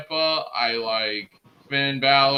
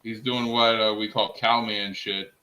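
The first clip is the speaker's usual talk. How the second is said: ordinary talk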